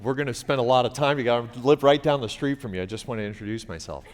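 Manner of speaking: rushed, nervous over-explaining; flustered dad hurrying through an introduction; flustered rambling. Retold or imitated. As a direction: babble it out fast and anxious. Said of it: "I'm like".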